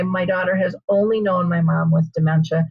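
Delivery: ordinary talk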